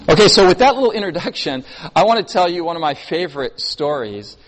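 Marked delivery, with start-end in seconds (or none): none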